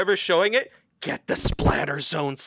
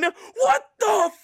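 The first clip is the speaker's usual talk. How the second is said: horrified screaming; 4 dB louder than usual. Retold or imitated. Friend reenacting buddy screaming at the gross scene, high wails. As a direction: scream in disbelief and horror. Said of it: "he just starts going".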